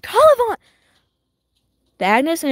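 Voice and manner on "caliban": high, loud burst of recognition; sudden exclamation. Real, not acted. Gamer spotting a name mid-rant, excited outburst. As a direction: blurt it out as it appears on screen. Sudden shout during gameplay.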